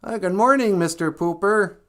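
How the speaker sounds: cheerful puppet voice calling out a greeting; Big Bird. Retold or imitated. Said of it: "He'd always be like".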